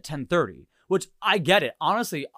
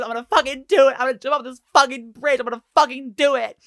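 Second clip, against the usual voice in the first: hysterical shout; 10 semitones higher, 8 dB louder, faster than usual. Retold or imitated. Rider threatens to jump.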